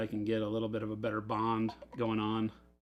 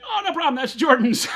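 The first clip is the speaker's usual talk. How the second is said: high, louder, breezy retold reply; mock cheerful relief; 10 dB louder than usual. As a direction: toss it off gleefully, pitched way up and louder. Retold relief, blaming Jordan.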